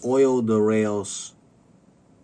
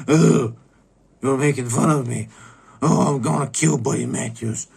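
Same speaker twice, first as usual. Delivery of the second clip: higher and louder, hammed-up indignation; mock outraged realization; 4 semitones higher, 7 dB louder than usual. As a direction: play her dawning outrage big. Woman realizing she was pranked.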